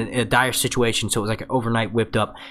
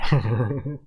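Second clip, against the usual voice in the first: laughing; 3 semitones lower than usual. Real, not acted. Laugh after quote.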